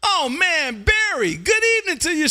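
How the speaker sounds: high, stretched-out call sign, then greeting; hearty radio hail. Real, not acted. Host greets Barry after hearing his call sign, hearty drawn-out hail. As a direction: hail a welcome caller in long, ringing words. Hails caller Barry by callsign.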